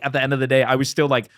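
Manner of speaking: ordinary talk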